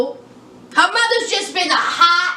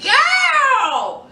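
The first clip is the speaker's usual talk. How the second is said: put-on exasperated girl; 7 semitones higher than usual. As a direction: shout girl in mock dismay. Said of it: "I was like".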